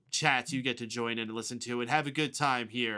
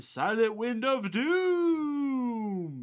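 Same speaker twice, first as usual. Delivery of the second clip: long high eerie wail on the last word; hammed-up, drawn-out ghostly howl; spooky ghost; 11 semitones higher, much slower than usual. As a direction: draw it out like a haunting wind. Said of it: "It's a spooky ghost".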